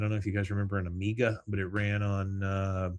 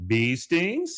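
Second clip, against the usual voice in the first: incredulous friend; 8 semitones higher, 5 dB louder than usual. Retold or imitated. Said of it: "says her friend".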